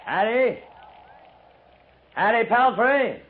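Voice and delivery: high, drawn-out call toward the cabin. Acted. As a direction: call her name across the yard, slow.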